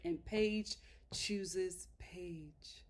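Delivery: slow, low, near-whispered announcement; hushed reveal. Real, not acted. Host revealing Paige finally chose herself, hushed and slow. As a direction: draw it out softly for effect.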